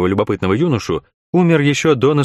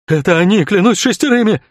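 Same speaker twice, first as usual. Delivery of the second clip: much higher, louder outcry; accusing shout; 10 semitones higher, 4 dB louder than usual. A narrator's reading. Innkeeper pointing at the strangers, high accusing shout.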